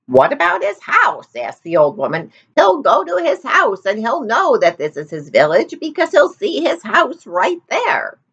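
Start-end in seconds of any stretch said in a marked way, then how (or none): 0.1-1.3 put-on old woman. A narrator's reading. 2.5-8.2 put-on old woman. A narrator's reading.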